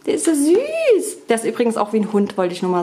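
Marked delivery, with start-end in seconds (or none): 0.1-1.3 high, slow cat talk; high cooing. Real, not acted. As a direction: coo it high and drawn out. (hohe, langsame Katzenstimme)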